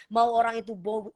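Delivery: ordinary talk, nothing marked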